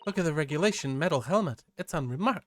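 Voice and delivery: keen, quick-talking sightseer; lightly voiced excited companion; voiced eager assistant. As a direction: point out the sights with eager wonder. Voices excited character in game.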